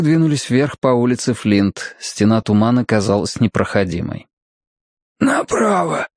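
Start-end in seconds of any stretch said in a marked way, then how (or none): 5.2-6.1 high, strained one-word order; commanding. A narrator's reading. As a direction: snap out a command without extra volume. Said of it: "скомандовал Фрост". (командуя, высоким напряжённым голосом)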